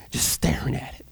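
near-whisper, very soft and low; hushed narration. A narrator's reading. Storyteller describes Agnes holding her first cake, hushed. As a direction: let it drop to an awed whisper.

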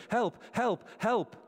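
imitating a shout for help from the water; mock shout for help. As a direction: call out "help" as if caught in the current, half-voiced. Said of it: "So we start shouting".

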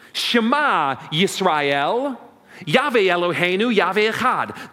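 Shema Yisrael chanted slowly in Hebrew; Hebrew recitation. Shown as a demonstration. Pastor showing the congregation the Hebrew prayer, solemn chant. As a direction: intone "Shema Yisrael, Yahweh Eloheinu, Yahweh Echad" slowly and solemnly. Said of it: "That's how you would say it".

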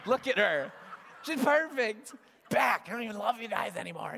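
high, loud, hammed-up dad outburst; mock gushing shout. Dad fawning over daughter before his sons, shouting playfully. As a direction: burst out over the baby, then bark off the boys.

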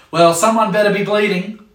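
put-on a stressed parent snapping at kids screaming for attention; harried parent. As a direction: snap back while busy. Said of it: "your response is".

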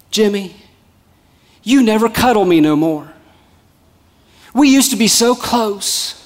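a hint of a plaintive old lady; old lady complaining. Old lady to husband in car, mildly plaintive. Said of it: "little old lady".

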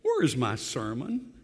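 usual speaking voice

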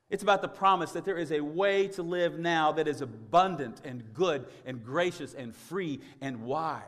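loud, fervent preaching; preaching at full force. Acted. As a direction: preach it out with fervour. Sermon climax.

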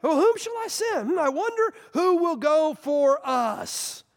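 voicing God musing. The triune God asking whom to send, musing. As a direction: wonder aloud as God. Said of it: "I heard the voice of the Lord".

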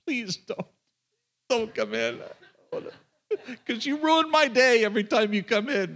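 laughing, pleading please don't; amused mock dread; inner thought, laughing. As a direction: chuckle through the inner plea. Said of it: "I'm thinking".

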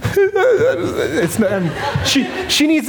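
real crying laugh breaking through words. Real, not acted. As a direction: voice cracks high into a teary laugh. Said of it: "not, like, a good talking crier".

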